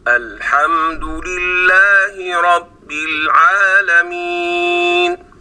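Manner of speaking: slow chanted verse rising high at the end; Quranic recitation. Acted. Teacher reciting the verse from al-Fatiha. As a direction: intone the verse slowly, lifting العالمين.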